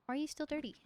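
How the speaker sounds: ordinary delivery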